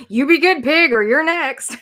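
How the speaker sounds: loud, playful warning to a pig; mock threat. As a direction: pretend to warn the pig.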